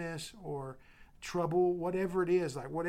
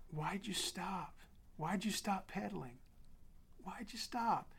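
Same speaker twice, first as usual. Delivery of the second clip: a father gently whispering to his fallen son; gentle not scolding; 5 semitones higher, 4 dB quieter than usual. Retold or imitated. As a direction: ask it softly, no blame at all. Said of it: "And it wasn't scolding".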